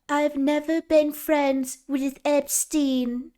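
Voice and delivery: high, slow, drawn-out accented impression; exaggerated First Lady parody voice; Melania Trump. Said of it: "That's my Melania impression".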